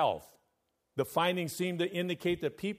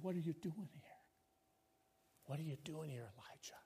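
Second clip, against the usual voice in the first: hushed whisper, much softer; God's still small voice; 2 semitones higher, 15 dB quieter than usual. Acted. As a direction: breathe it gently as God. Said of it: "God speaks to you ... still small voice".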